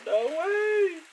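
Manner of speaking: high falsetto no way; amazed. Real, not acted. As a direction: squeal in disbelief. Amazed by the smell.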